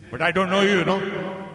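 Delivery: loud, high mock brush-off; a hammed-up rude retort; imitating a dismissive man. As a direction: snap it back at him, loud and curt. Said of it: "He says".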